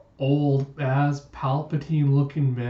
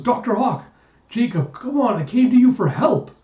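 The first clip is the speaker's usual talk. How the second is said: high, louder acted pleading; desperate plea; 10 semitones higher, 7 dB louder than usual. Seized man appealing to the doctor for help, desperate plea. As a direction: beg urgently, pitch leaping up.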